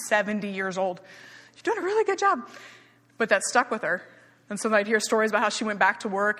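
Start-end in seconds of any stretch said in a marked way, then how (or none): 1.5-2.5 much higher, cooing mock-cheerleader praise; exaggerated encouragement. Instructor praising a seventy-year-old aerobics student, exaggeratedly encouraging. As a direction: gush the compliment in a high lilt. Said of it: "I would try to compliment her".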